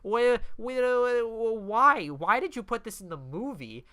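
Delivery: high, loud, baffled outburst; exasperated, stammering bafflement; incredulous rant. Real, not acted. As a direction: demand an explanation in disbelief. Ranting at random movie moment.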